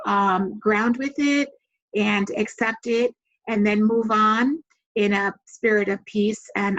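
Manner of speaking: normal talking voice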